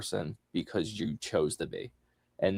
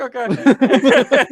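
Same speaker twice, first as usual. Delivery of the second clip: excited okay; 11 dB louder than usual. Retold or imitated. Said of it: "I'm like".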